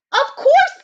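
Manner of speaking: high, bright little rabbit voice; perky Hare. Acted. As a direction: play the chipper bunny.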